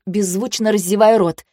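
plain reading voice

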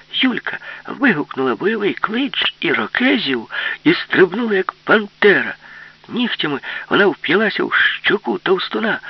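high, loud, thrilled storytelling; excited narration. A narrator's reading. Narrator telling Yulka's war-cry attack, excited. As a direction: race through the battle cry scene with gusto.

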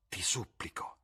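whispered, begging; whispered plea. Acted. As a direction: beg him under your breath. Basil begging Dorian. (supplica sussurrata)

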